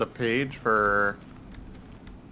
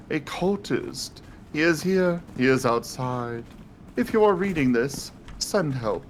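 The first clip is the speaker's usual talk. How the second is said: lightly posh reading of the letter; 6 semitones higher than usual. Acted. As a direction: read it like a haughty aristocrat. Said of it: "I love that posh voice".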